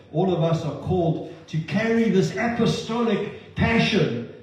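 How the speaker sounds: loud, emphatic sermon delivery; impassioned preaching. Real, not acted. Preacher calling all the congregation to pray and send, impassioned. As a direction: drive each call home with force.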